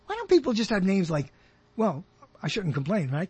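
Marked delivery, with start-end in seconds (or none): none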